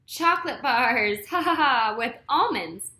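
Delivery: loud laughing through the list; spoken ha ha ha mid-line. A narrator's reading. Mac laughing roll call.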